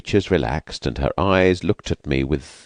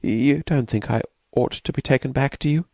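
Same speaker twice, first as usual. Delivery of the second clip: anxiously; 9 semitones higher than usual. Acted. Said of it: "anxiously repeated her former inquiry".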